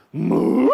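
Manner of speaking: low, loud farm-cow moo. Shown as a demonstration. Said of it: "A cow sounds like this".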